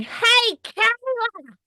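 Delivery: very high mock holler; imitated yell. Host recalling yelling round the house, high mock yell. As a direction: call it out high, stretching the name. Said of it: "just yelling".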